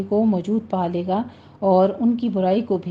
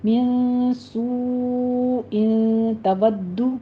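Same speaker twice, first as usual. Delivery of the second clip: slow, drawn-out Quranic recitation joining the words; demonstrated recitation; 3 semitones higher, much slower than usual. Shown as a demonstration. Teacher models the joined reading for students, slow and drawn out. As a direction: recite it slowly, stretching each sound. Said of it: "ملا کے پڑھ لینا ہے".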